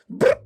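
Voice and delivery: short imitated computer blip; chat bot bloop. Streamer mocking chat that treats him as a bot, imitated bloop. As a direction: pop out a quick electronic bloop.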